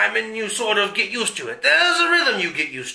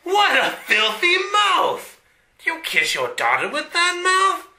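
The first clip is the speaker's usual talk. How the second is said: mock outrage; 9 semitones higher than usual.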